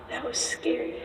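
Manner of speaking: hushed scared whisper; nervous night vlog whisper. Real, not acted. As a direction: breathe it out, spooked. Scared in dark tunnel.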